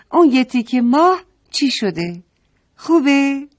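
put-on eager mother asking the kids; playful invitation. Mother proposes a game.

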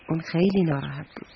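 ordinary delivery, nothing marked

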